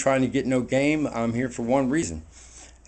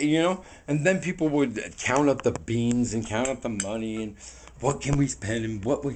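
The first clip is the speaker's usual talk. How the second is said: ordinary talk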